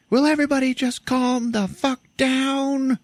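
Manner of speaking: very high, slow strained mock yell; high hammed-up plea for calm; retold yell. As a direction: yell to stop everyone. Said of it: "Bree just yells out to everybody".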